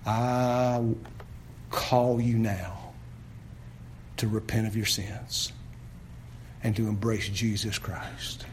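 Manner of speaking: low, hushed pleading invitation; soft altar call. Acted. Pastor calling sinners to repent, softly and low. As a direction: draw them gently to repent.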